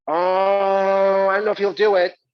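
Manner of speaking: a coy producer holding back; hesitant producer. Retold or imitated.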